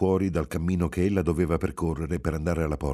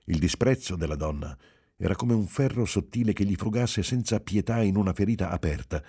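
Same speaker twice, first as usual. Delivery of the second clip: ordinary delivery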